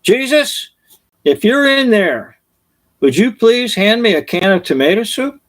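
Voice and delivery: high mock yell into the dark; a kid calling into the pantry; child calling out. Johnny calling into the dark pantry, high mock yell. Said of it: "and yelled".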